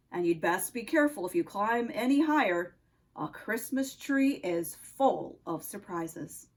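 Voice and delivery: put-on low-pitched Russian-accented nutcracker; deep Russian accent, a sentry's challenge. Nutcracker questions Oscar on the tree, low Russian accent. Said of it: "spoke in a Russian accent".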